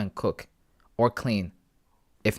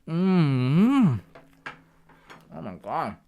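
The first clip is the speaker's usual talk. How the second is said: ordinary talk, nothing marked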